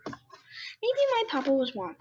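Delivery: a hint of a small, curious bunny voice; lightly voiced bunny child.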